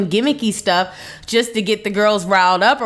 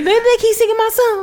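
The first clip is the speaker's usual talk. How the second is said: high-pitched; 13 semitones higher, faster than usual. Retold or imitated. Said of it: "Little titty".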